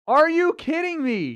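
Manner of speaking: high, slow disbelieving outburst; incredulous, drawn out and high; disbelief. Real, not acted. Outraged at forty-five minute wait.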